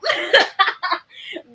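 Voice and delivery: a high, delighted burst of laughter; a high squeal of a laugh. Real, not acted. Laughing at the moment.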